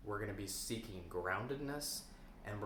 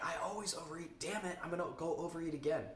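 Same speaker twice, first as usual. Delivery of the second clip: higher, fast angry inner voice; fast, higher self-scolding; frustrated self-talk; 7 semitones higher, faster than usual. Retold or imitated. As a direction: snap at yourself in frustration. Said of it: "your emotional reaction is like".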